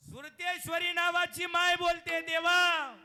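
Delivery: very loud, high sermon cry; full-force preaching shout. Acted. Kirtankar voicing the mother's plea, shouting.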